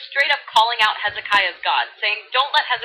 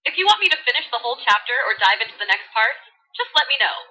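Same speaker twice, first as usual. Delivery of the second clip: put-on bubbly valley girl voice. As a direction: read scripture like a valley girl. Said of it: "Valley Girl edition".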